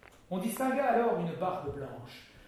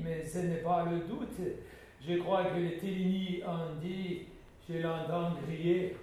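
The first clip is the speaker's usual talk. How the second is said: a German soldier boasting calmly; German accent; 2 semitones higher, 5 dB louder, slower than usual. Acted. Ben the German explaining the killing in accented French.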